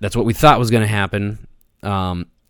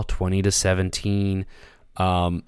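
normal talking voice

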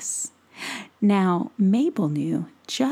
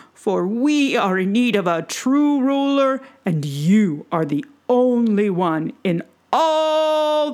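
slower, louder solemn pledge; dwarf chief and troll prince; 4 dB louder than usual. Acted. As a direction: proclaim loyalty slowly and loudly. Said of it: "together they said".

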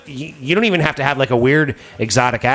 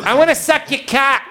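very high, exaggerated Boston accent; 14 semitones higher than usual. Boston girl answers the caller, hammed-up Boston accent. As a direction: squawk it as a mock Boston girl. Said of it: "a girl from Boston".

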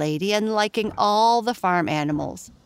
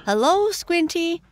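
high bright piggy hello, pitched well up; little pig's greeting; 12 semitones higher, 3 dB louder than usual. Acted. Little pig Squinty greeting in a high voice.

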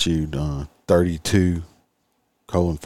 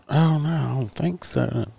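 high, slow innocent drawl; mock woman voice; 8 semitones higher, slower than usual. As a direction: play dumb, slow and high. Suspended woman answers.

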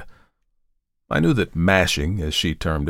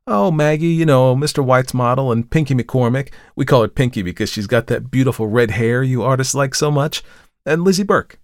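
put-on higher, bubbly young model's voice; chattering girl; 6 semitones higher, 4 dB louder than usual. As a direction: gush about last night. Tessie lists her friends.

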